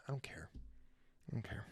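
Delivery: very soft, slowing whisper; hushed, indifferent mumble to oneself; whispered aside. Real, not acted. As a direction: whisper it as a throwaway confession. Aside admitting indifference to Eiffel Tower.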